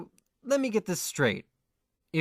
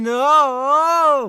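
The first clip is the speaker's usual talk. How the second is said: loud high yelp of despair; anguished cry; 6 semitones higher, 9 dB louder than usual. Acted. Godai cries out as he is dragged back. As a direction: cry out as you fall.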